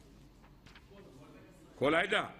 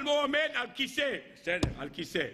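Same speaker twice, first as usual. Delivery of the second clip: ordinary talk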